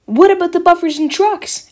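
high, loud worried asking; anxious question. Acted. Rosie asks Sir Topham Hatt about damage, high and worried. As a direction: pipe up loudly with concern.

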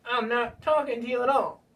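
high-pitched sulking puppet voice; put-on puppet voice, refusing to talk; Bill Clinton puppet. Puppet snubs his handler, sulky. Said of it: "this is, uh, Bill Clinton".

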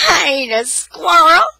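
put-on high, loud child's squeal; playful protest. A narrator's reading. Tanya protesting to the dog licking her, playful squeal.

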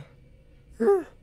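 long audible yawn. Real, not acted. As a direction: let out a big tired yawn. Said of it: "I always yawn around this time".

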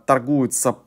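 usual speaking voice

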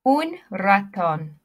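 slow, clear Spanish accent on Un gato, Un perro, Un conejo, Un ratón. Shown as a demonstration. Teacher models pet words in a Spanish accent. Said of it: "repeat the words after me in your best Spanish accent".

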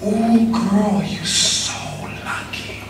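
slow, hushed whisper to a friend. Retold or imitated. Girlfriend's friend whispering in her ear, soft slow whisper. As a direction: whisper it softly, drawing it out. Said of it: "heard her friend whisper to her".

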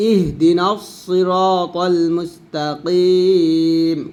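slow, drawn-out chant; measured tajweed recitation; Quran recitation. Shown as a demonstration. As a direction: recite it slowly, stretching each vowel. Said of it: "তারপর দেখুন এখানে".

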